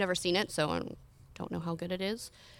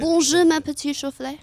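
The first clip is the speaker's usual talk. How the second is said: hammed-up French accent, higher and louder; 7 semitones higher, 6 dB louder than usual. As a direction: play it up as an over-the-top French charmer. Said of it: "I wanna hear this in a French accent".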